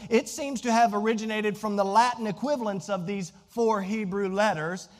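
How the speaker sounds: ordinary delivery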